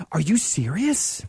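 slow, drawn-out mock astonishment; hammed-up incredulous question; ridiculous disbelief. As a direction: play the gullible victim, stretching the question. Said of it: "And I was ridiculous".

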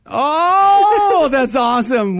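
high, loud, laughing yelp of delight; delighted cry. Real, not acted. Host remembers their Halloween costume, high delighted yelp. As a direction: squeal at the memory.

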